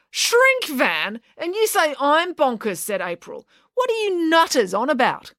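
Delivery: high, scornful disbelief; incredulous scorn. A narrator's reading. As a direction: scoff at them. April incredulous, insulting.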